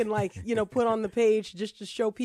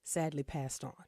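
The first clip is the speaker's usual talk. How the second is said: whispering; 4 semitones lower, 6 dB quieter than usual. Real, not acted. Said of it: "I don't know why I have to whisper this".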